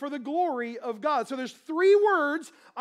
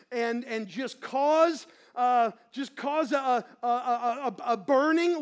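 prayer; 5 semitones lower than usual. Real, not acted. Opening prayer before the sermon.

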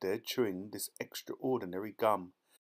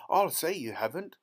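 loud, raised retort; upset mother, higher and louder; indignant cry; 6 semitones higher, 6 dB louder than usual. A narrator's reading. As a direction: cry out in outrage. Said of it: "cried Missus Beauregard".

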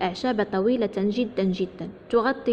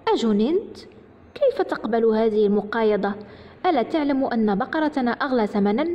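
ordinary delivery

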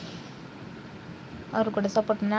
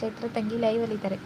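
normal talking voice

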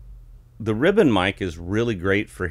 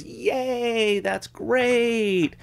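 high, delighted cheering; excited cheer; much slower than usual. Retold or imitated. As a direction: cheer it like thrilled fans. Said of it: "everybody goes".

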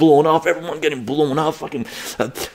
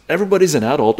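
ordinary delivery

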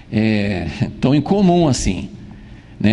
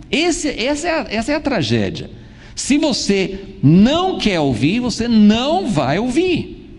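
emphatic preaching; 9 semitones higher than usual. Acted. Preaching at full force.

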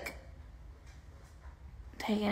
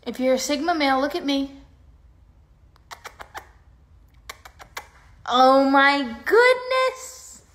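excited pet talk; 5 semitones higher, 9 dB louder than usual. Real, not acted. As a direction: gush over the puppy. Excited at puppy.

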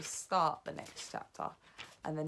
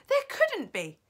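high, loud protest; shouted disbelief; 10 dB louder than usual. A narrator's reading. Other witches contradicting her, shouting in disbelief. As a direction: shout it back, pitched high. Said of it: "the others shouted".

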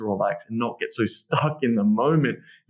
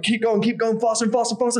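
urging; 10 semitones higher than usual. Shown as a demonstration. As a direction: egg her on faster. Urging partner during sex.

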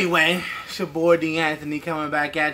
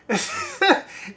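a short, high giggle fit; high burst of laughter. Real, not acted. Reviewer cracking up at the movie's twist, high laughter.

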